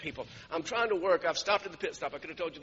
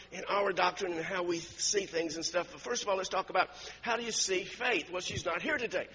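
normal talking voice

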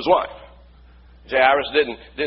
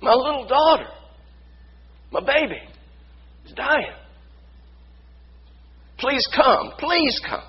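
tearful, higher-pitched pleading with long pauses; cracking voice; 8 semitones higher than usual. Acted. Preacher voices a father begging Jesus for his dying daughter, cracking voice. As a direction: plead brokenly, a father close to tears. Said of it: "hear the crack in his voice".

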